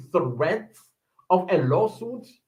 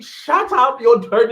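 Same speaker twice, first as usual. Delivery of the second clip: high sharp retort, louder; imitating an angry lawyer; snapping shut up; 6 semitones higher, 6 dB louder than usual. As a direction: cut them off. Retold courtroom snap.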